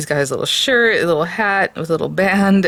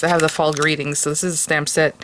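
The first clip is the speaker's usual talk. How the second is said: normal talking voice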